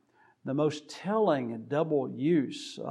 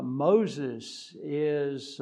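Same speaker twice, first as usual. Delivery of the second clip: ordinary delivery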